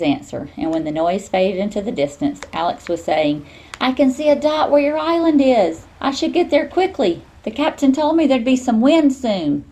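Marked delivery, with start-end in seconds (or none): 3.8-9.6 put-on high, loud, bubbly young voice; bursting with news; excited girl on the phone. Alex on the phone again.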